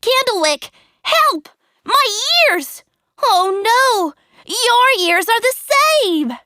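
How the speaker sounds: high, loud frantic calling; panicked. A narrator's reading. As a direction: panic over growing ears. Puppet turning into donkey.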